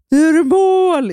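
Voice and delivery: high, loud, slow goal yell; stretched-out stadium shout; mock goal shout. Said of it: "Han bara".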